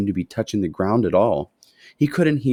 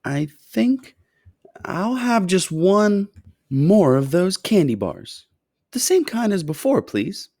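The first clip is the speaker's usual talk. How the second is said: put-on high, slow, hesitant boy's voice; boy asking quietly; 11 semitones higher, 3 dB louder, slower than usual. A narrator's reading. Charlie shyly asking the shopkeeper for one more bar, high and slow. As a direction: ask shyly like a small boy. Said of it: "he said quietly".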